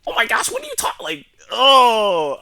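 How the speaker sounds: very high, loud mocking squeal; exaggerated mock-nerd outburst; virgin voice. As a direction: put on a dorky, whiny loser voice. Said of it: "that fucking virgin voice".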